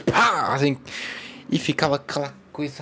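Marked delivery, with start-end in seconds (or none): 0.0-0.5 loud mouth-made slap noise; slap sound. Retold or imitated. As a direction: smack it out like a hard slap. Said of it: "ele chegava". (imitação alta de um tapa)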